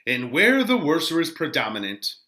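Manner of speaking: slow, swelling Shakespeare reading with loud peaks; dramatic reading. Acted. Teacher reading Friar Lawrence's speech to the class, dramatic and slow. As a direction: declaim the friar's speech with feeling.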